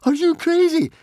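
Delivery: high, loud, incredulous cry; interrupting. A narrator's reading. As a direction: cut in with a panicky yell. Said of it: "interrupted Fishlegs".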